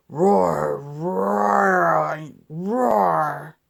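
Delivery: low rumbling roar like a truck engine. Pretend. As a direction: growl out the engine noise, drawn out. Truck engine sound in story.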